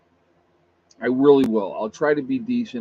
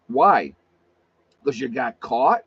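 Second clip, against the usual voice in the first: ordinary talk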